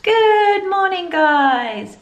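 very high, drawn-out, loud hello; sing-song greeting. Real, not acted. Vlogger opening the day's video, sing-song greeting. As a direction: stretch the greeting out high and gleeful.

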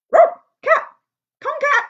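high, loud barking voice; dog calling. Acted. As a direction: bark the words out like an eager dog. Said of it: "So the dog called the cat".

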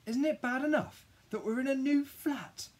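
very high, loud complaint; indignant protest. A narrator's reading. Child refusing Dad's new school, high indignant wail.

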